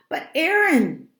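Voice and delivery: high, loud, drawn-out wail; frustrated cry to a friend; exasperated protest. A narrator's reading. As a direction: whine it loudly in protest.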